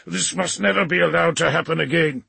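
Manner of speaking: vehement, gruff insistence; angry growl. A narrator's reading. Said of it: "Rantal growled".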